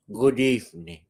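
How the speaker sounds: lightly voiced horror host welcome; spooky Hitchcock. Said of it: "just a spooky impression of Hitchcock".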